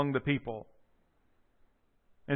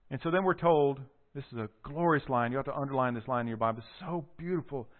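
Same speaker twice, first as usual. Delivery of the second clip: ordinary delivery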